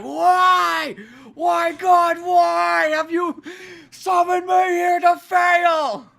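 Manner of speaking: very high, loud, slow anguished cry; mock despairing shout; hammed-up wail to God. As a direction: beg the heavens like a tragic hero. Kid at PlayStation wailing to God.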